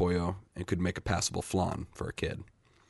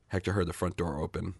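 plain reading voice

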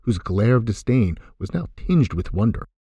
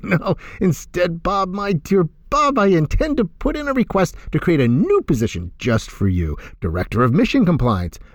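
sing-song; 8 semitones higher than usual. Acted. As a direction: tease him with a singing lilt. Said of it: "his voice almost sing-song".

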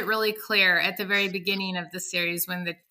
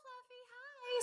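put-on sweet children's voices. The whole class greets the new guinea pig, high and sweet. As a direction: chirp it like a cheery little kid. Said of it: "and all the little children".

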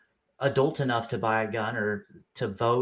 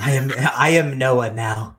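mock proclamation; 9 dB louder than usual. Banter, mock claiming role.

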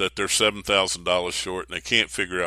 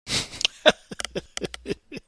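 unvoiced laugh. Real, not acted. Laughs at the burp line.

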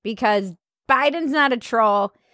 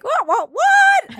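high squeaky cartoon what; South Park what; 4 dB louder, slower than usual. Mocking the melodrama, squeaky cartoon what.

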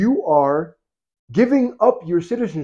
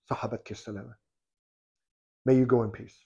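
soft farewell; 6 semitones lower, 11 dB quieter than usual. Retold or imitated. Umar softly bids her farewell.

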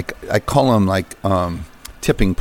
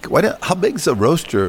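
quoting his friend; 6 semitones higher than usual. Retold or imitated. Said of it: "finally he goes".

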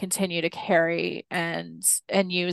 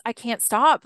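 ordinary delivery

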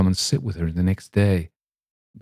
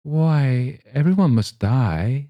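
wonderingly; 6 semitones higher, 3 dB louder, slower than usual. Acted. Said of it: "said I wonderingly".

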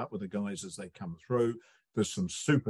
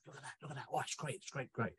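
hushed, much softer eager murmuring; sly pack whisper; 2 semitones higher, 14 dB quieter, faster than usual. Retold or imitated. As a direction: whisper like wolves spotting prey. Wolves hunting in packs, whispered.

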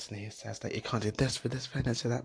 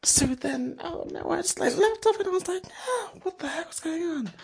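much higher, breathless retelling of the shock; startled retelling; 4 dB louder than usual. Retold or imitated. Telling a listener about a chair banging into hers, much higher and excited. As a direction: pitch shooting up as the bang comes back.

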